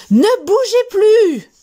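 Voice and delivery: high, loud, slow shout to freeze; yelling. Acted. Teacher yelling at the class not to move. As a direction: order the whole class to freeze at full voice. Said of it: "La maîtresse hurle".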